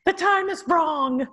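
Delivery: high, slow mock-helpless protest. Worker answering agency about Google Maps, high mock protest. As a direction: plead it high and drawn out, half laughing.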